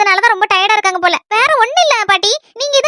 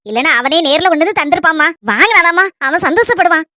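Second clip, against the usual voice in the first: ordinary talk, nothing marked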